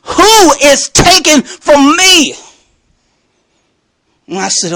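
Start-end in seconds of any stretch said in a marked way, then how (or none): 0.1-2.3 slow, high, word-by-word demand; indignant preacher's slow challenge. Retold or imitated. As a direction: draw out each word in defiance. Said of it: "that's my attitude".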